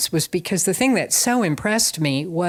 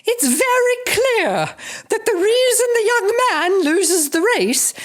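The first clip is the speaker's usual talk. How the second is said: hammed-up imperious old lady, laughing as she goes; Lady Bracknell voice; 13 semitones higher, 3 dB louder than usual. A dowager in the audience lecturing the room on nutrition, grand and high. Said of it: "in a very Lady Bracknell voice".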